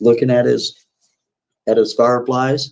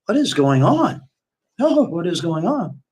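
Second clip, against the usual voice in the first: high, startled what is going on; alarmed question; 7 semitones higher than usual. Retold or imitated. Kathy waking up, asking her date what is happening, alarmed. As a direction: wake up confused and alarmed. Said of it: "Kathy wakes up and goes".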